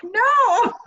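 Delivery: high, loud, drawn-out no; hammed-up indignant denial; mock yelled denial. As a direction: protest it at the top of your range. Mock reply to accusing son.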